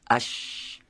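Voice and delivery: اش with a long hissing, voiceless sh; drawn-out sh. Shown as a demonstration. Teacher showing students the letter shin, drawn-out voiceless sh. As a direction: let the sh in اش flow on without voice. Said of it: "به صورت سایشی این حرف".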